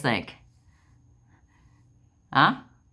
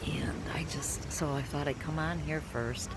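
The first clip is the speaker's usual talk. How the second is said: hushed, breathy start; quiet whispered aside; 2 semitones higher, 7 dB quieter than usual. Real, not acted. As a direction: whisper it softly.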